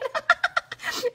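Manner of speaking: a very high, delighted laugh; real giddy laugh; excited high laugh. Real, not acted. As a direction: laugh out in pure glee. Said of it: "I'm super excited".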